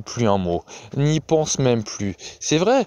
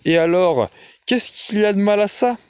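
a firm cut-in, mildly raised; forceful interruption; 8 semitones higher than usual. A narrator's reading. Fay cuts in on Gussie, forcefully. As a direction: push back hard on the question. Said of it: "Fay l'interrompit avec force".

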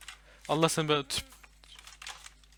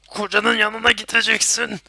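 slow, loud, higher taunting put-on voice; menacing villain voice; 8 semitones higher, 11 dB louder, slower than usual. Gamer taunting a monster, slow villain voice. Threatening enemy in game.

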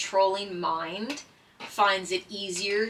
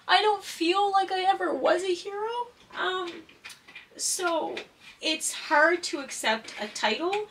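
holding back tears; 11 semitones higher than usual. Real, not acted. Former paramedic on being called a hero, near tears. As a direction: voice climbing high, on the edge of tears. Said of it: "sorry, I'm trying so hard not to cry".

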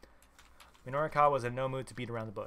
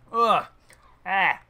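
loud high angry snap; loud, high, furious no way; snapped; 6 semitones higher, 13 dB louder, much slower than usual. A narrator's reading. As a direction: bite his head off, loud and high. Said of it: "Minorikawa snapped".

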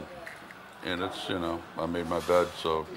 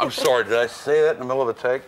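loud, raised-pitch play-acted fluster; mock apology; 4 semitones higher, 7 dB louder than usual. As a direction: act caught out on set, voice up. Acts blurting out mid-scene.

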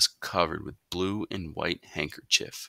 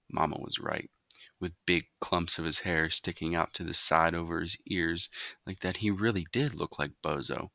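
ordinary delivery, nothing marked

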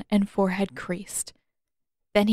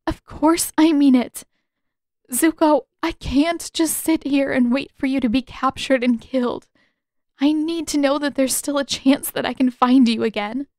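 put-on higher, tearful young woman, pleading and louder; Katara pleading; 6 semitones higher, 5 dB louder than usual. A narrator's reading. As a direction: beg him through rising tears. Katara insists to Zuko.

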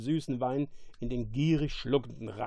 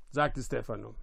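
ordinary delivery, nothing marked

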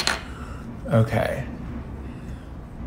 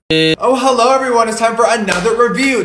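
high bright host greeting; perky host intro; 11 semitones higher, 5 dB louder than usual. Acted. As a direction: open like a TV presenter. New review opening.

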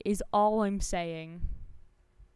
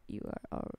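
whispered praise; 4 semitones higher, 5 dB quieter, much faster than usual. Real, not acted.

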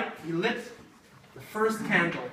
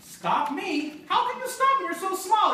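high sneering goblin voice; mocking goblin; 9 semitones higher than usual. Goblin scoffing at tiny Herschel, high and sneering. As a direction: scoff at him like a cocky goblin.

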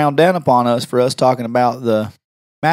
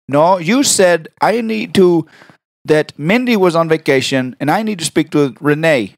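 slow, insistent put-on caller; prank caller voice; 3 semitones higher than usual. Correcting the receptionist, slow prank caller voice. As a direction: draw out each word as the prank caller. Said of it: "Why can you talk like, exactly like that".

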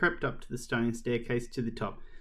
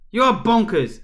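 high, loud accusation; exasperated outburst; 9 semitones higher, 9 dB louder than usual. Acted. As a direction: cry it out in acted exasperation. Caretaker's outraged retort to the girl.

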